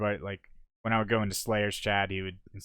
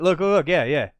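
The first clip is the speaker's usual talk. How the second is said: excited; 8 semitones higher, 8 dB louder than usual. Real, not acted. Excitedly pointing at replay.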